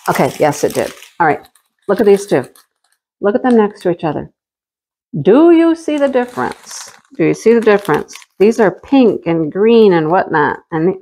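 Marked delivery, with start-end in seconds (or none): 5.2-6.9 lifted excited question; 1 high eager question. Pretend. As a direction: coax the viewers to look closely. Repeats question, first higher.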